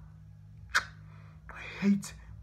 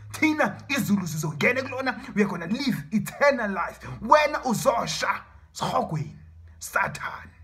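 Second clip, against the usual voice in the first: loud, raised, fast preaching; defiant proclamation; 4 semitones higher, 6 dB louder than usual. Real, not acted. Believer taunting Satan with salvation, loud and defiant. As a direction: proclaim victory at full voice.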